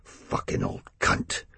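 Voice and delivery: whispered, slow and bitter; under his breath. A narrator's reading. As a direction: hiss it under your breath. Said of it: "under his breath".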